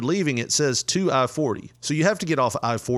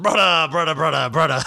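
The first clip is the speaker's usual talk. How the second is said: put-on louder giggly drunk chant; imitating a laughing drunk buddy; 3 semitones higher, 4 dB louder than usual. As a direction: mimic a tipsy friend cracking up. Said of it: "you're laughing and going".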